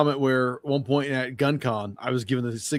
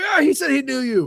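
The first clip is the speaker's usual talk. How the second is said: excited retelling; 12 semitones higher, 4 dB louder than usual. Retold or imitated.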